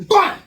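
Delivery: very loud high smack; loud slap noise. Retold or imitated. Imitating slapping his brother, loud. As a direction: slam the slap out.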